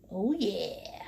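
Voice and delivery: breathy little whisper of approval; whispered aside. Real, not acted. Painter admiring her fuzzy bunny, hushed whisper. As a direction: whisper it to yourself, pleased.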